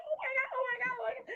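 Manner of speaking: tiny high, soft squeal; excited puppy. Acted. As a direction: imitate an overexcited puppy, squealing quietly. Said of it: "how little puppies get excited".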